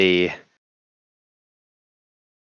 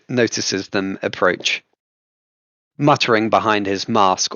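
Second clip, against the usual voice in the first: usual speaking voice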